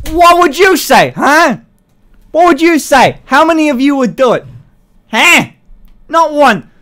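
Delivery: high, loud taunting challenge; challenging shout. Real, not acted. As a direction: call out the chat, loud. Ranting at chat, challenging.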